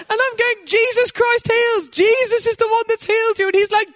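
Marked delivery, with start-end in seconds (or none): 0.7-3.5 excited preacher; excited proclamation. Retold or imitated. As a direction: proclaim it in joyful awe. Excited proclamation to healed man.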